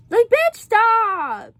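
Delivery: high, loud, drawn-out "stop"; exasperated self-scolding. Real, not acted. Telling oneself to stop crying, loud and exasperated. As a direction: scold yourself, stretching it out loudly.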